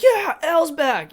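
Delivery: high, loud, drawn-out whoop; excited cheer. Retold or imitated. Viewer sees Mike floating and cheers Elle, excited. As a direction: cheer it like a thrilled fan.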